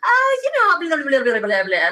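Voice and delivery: high hesitant dodge, lightly voiced; mock evasive mumble. Dodging guests asking about her book, mock evasive. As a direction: trail off into vague blah blah. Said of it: "I was a complete wimp".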